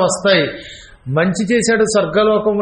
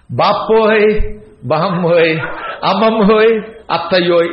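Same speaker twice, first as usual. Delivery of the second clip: slow, drawn-out mock keening; imitated mourning wail; slower than usual. Hired mourners cry out to the dead relative, mock wailing. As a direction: wail out each kinship name like a hired mourner. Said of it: "అలా ఏడవడం".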